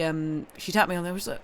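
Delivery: normal talking voice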